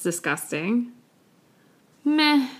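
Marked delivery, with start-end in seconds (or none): 2.0-2.5 a higher meh with a shrug; indifferent meh. Shown as a demonstration. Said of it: "I don't know".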